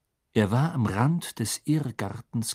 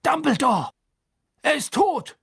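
high, horrified shout; alarmed cry; 14 semitones higher, 4 dB louder than usual. A narrator's reading. Fudge telling Dumbledore of the death, alarmed cry. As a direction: cry out in shock, pitched high.

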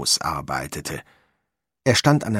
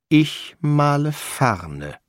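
slow, higher, stately declaration; stubborn insistence; 6 semitones higher, much slower than usual. Acted. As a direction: draw every word out, unbudging. Painter stubbornly insisting on ferns.

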